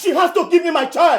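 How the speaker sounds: high, loud demand; angry shout. Acted. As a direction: yell it at the grandmother. Father demanding his child.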